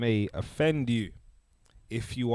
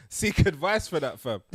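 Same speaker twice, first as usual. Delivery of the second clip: normal talking voice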